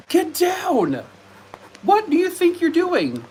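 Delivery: high, exasperated telling-off; high pet-scolding no no no; scolding dog. Real, not acted. Scolding dog climbing desk.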